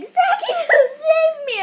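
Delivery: high, slow fake panic shout; hammed-up frightened cry for help; mock scared cry. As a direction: wail the name like a scared kid. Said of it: "Yeah, he's like".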